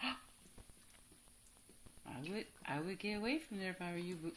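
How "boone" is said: ordinary talk, nothing marked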